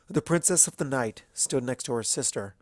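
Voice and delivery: plain reading voice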